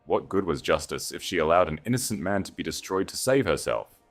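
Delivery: ordinary delivery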